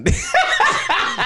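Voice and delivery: high, loud burst of laughter; real laughter. Real, not acted. Laughing at co-host's Dirk Nowitzki claim, real laughter. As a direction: crack up in disbelief.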